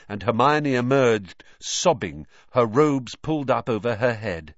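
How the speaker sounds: ordinary delivery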